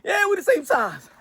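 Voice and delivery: high, wailing mock plea for money; mock Crawford begging. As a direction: whine high like a boxer begging for the bag. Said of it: "he'll be like".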